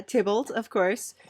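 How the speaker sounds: ordinary talk, nothing marked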